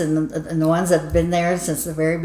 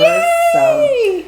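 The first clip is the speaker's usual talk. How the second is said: high squealing cheer; excited cheer; 5 dB louder than usual. Real, not acted. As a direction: cheer it out, thrilled. Cheering nine thousand subscribers.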